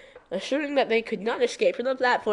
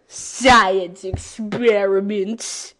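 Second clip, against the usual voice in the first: slow, louder, stretched-out reading; drawn out; 5 dB louder, much slower than usual. Acted. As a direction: drag out every syllable. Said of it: "Why'd I say it like that?".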